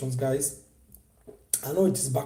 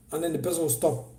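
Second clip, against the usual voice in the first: normal talking voice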